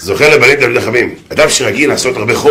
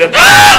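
sudden high "ah"; hammed-up pained shriek; mock yelp; 4 dB louder than usual. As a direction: cry out as if struck. Acted cry under blows.

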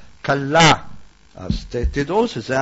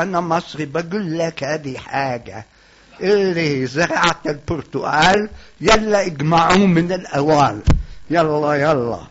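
put-on heavy Egyptian Arabic accent; 3 dB louder than usual. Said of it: "מבטא כבד כזה".

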